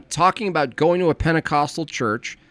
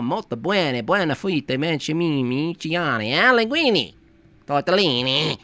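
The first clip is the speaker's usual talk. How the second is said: mock Italian tongues; 4 semitones higher than usual. Speaking in tongues that sound like Italian, mock Italian accent. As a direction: fake Italian gibberish, lightly voiced. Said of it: "my tongues sound exactly like Italian".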